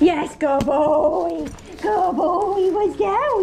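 high, slow, drawn-out dog praise; squeaky praising a dog. Real, not acted. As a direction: gush over the dog in a squeaky voice.

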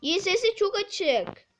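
calling out. Real, not acted. Asking someone nearby to turn volume down, loud call. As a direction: call to someone in another room.

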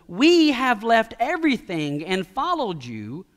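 "we" stretched out, high and strong; emphatic "we". Acted. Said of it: "underline the little word "we." ... It's emphatic".

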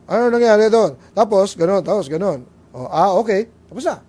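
loud, brisk husband just wanting the facts; men reporting. Retold or imitated. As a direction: bark out a quick factual report like a man. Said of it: "Ang mga lalaki ... report".

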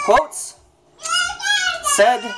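squeaky high voice sliding upward; helium voice. Real, not acted. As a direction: voice shooting way up as the helium kicks in. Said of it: "on helium".

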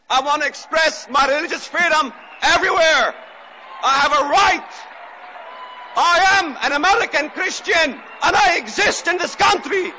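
rallying oratorical shout, rising in pitch; defiant shouting. Real, not acted. Preacher claiming religious freedom before a crowd, defiant shout. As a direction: build from firm insistence to a defiant cry.

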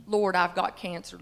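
ordinary talk, nothing marked

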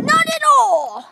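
high, loud and slow protest; emphatic loud denial. Real, not acted. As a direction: insist loudly it isn't.

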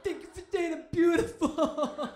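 loud, high, laughing thank-yous; overwhelmed gratitude. Retold or imitated. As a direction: gush thanks to the kind stranger. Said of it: "with my rice face".

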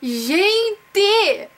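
a sudden loud shout of shock at the screen; surprised exclamation. Real, not acted. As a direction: burst out in real disbelief. Reacting to her grade.

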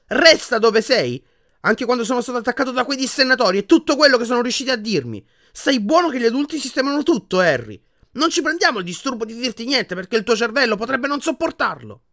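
high, loud, sustained tirade; furious yelling. A narrator's reading. Harry raging about being told nothing, furious yelling. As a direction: hurl the quoted orders back in outrage. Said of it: "urlò".

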